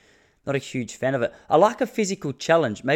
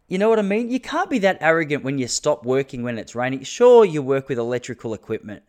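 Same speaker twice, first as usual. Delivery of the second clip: usual speaking voice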